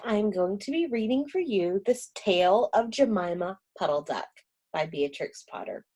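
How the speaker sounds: usual speaking voice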